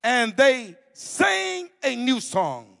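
slow, weighty reading; scripture reading. Acted. Preacher reading Revelation to the conference, slow and solemn. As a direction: let each image land.

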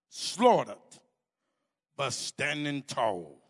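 slow, weighty reading; scripture reading. Acted. Preacher reading Revelation to the conference, slow and solemn. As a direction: let each image land.

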